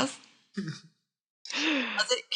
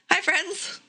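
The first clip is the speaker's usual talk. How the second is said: high, unsure hello; nervous high greeting; timid greeting; 7 semitones higher than usual. Acted. Dinsby greets strangers, timid and high. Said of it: "Dinsby will kind of be like".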